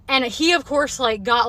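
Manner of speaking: ordinary delivery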